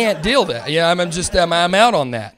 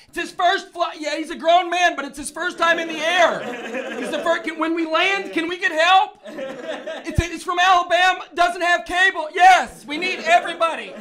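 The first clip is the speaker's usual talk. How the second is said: much higher, sing-song mock attendant; doting flight attendant; 12 semitones higher, slower than usual. Flight attendant fussing over first-time flyer, high sing-song. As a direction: coo over the grown man like a toddler. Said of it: "patting me on the head".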